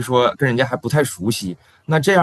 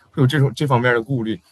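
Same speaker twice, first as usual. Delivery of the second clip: ordinary talk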